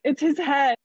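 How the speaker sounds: loud, high burst of disbelief; excited announcement. Retold or imitated. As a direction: blurt it out loud and high, stunned. Reaching down, feeling baby crowning.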